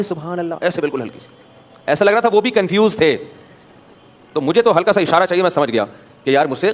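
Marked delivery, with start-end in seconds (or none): 0.0-1.3 a soft, thin, hesitant "سبحان اللہ"; faint voice. Retold or imitated. A confused worshipper behind the imam, softly prompting. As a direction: barely voice the words, unsure and softer. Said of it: "ہلکی سی منحنی سی آواز آئی".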